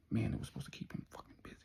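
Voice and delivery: quick, hushed, annoyed whisper; frustrated whisper. Retold or imitated. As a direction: hiss it fast so nobody hears. Brian muttering while door knocked.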